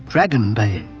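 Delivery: plain reading voice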